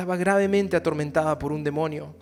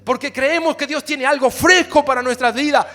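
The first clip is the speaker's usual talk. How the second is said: fiery preaching; 7 semitones higher, 12 dB louder than usual. Acted. Pastor shouting to his congregation, fiery preaching. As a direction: preach it out to the church.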